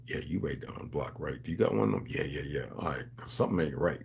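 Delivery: low, quiet conspiratorial talk; hushed talk. Retold or imitated. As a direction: murmur like sharing a secret at the table. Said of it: "we converse like that".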